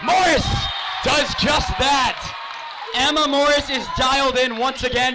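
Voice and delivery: high, loud big-play call; excited shout. Real, not acted. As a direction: yell it as the point lands.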